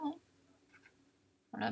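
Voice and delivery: normal talking voice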